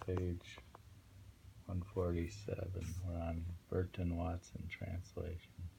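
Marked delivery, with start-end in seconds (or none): none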